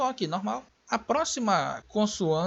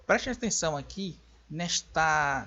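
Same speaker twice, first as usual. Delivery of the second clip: ordinary delivery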